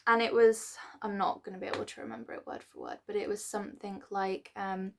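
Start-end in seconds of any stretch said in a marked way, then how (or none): none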